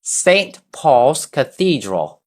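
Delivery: "Saint Paul's Cathedral" slow, stress on "Saint", falling at the end; slow teacher model phrase; model for repeating. Shown as a demonstration. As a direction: say it slowly for the class to echo. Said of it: "show me some teamwork".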